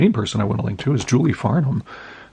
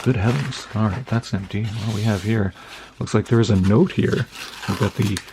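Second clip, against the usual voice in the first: ordinary talk, nothing marked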